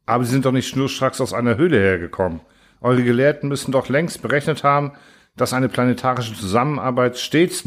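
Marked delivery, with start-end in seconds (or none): none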